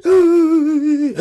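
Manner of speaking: loud, high hammed-up sob; mock sobbing. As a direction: burst into theatrical high-pitched crying. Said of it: "别哭了".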